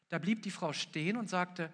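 ordinary talk, nothing marked

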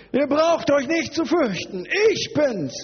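high, projected proclamation; lautes Zurufen. Acted. Acts Jesus calling from the water. (laut und hoch über das Wasser rufen)

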